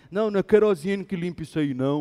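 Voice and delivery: fast, lightly voiced dismissive mechanic; brushing-off mechanic. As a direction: rattle off the excuse quickly.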